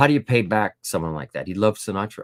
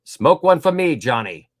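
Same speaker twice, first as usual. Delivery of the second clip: mild mock [name]; 5 semitones higher, 9 dB louder, slower than usual. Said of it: "[name] grabs the mic from him and says".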